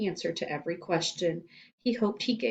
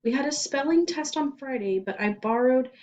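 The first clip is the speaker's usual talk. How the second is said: higher, nervous rushed answer; scared boy; 7 semitones higher, 4 dB louder than usual. Acted. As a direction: fumble for an excuse.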